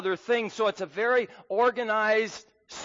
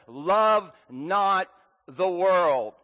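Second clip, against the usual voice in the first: each word slow and punched, louder; emphatic command; 4 dB louder, much slower than usual. Retold or imitated. Preacher quoting John to the congregation, slow and emphatic. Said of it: "John is very emphatic here when he says".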